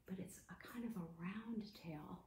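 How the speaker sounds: a soft whisper, like a secret; whispered clue. Acted. Teacher giving kids a secret clue, whispered. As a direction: lean in and whisper it.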